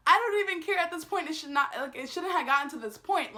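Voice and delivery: high, loud retold protest; worked-up outburst, settling slightly; exasperated. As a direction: argue back, fed up. Acted argument with the cashier.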